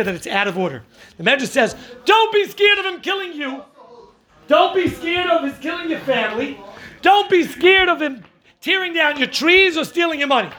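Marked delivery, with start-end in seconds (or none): 2.0-10.5 high, forceful declaration; emphatic proclamation. Retold or imitated. Rabbi reads the Medrash to his class, high emphatic declaration. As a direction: proclaim each line like a command.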